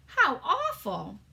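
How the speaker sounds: put-on a little girl shocked at the screaming plant; Sophie voice, horrified. Sophie reacting to the giant, slow and horrified.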